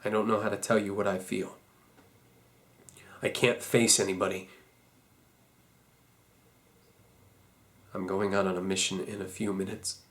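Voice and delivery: very low, soft, hopeless; a quiet farewell, all fight gone; hushed despair. Acted. Farewell before a last mission.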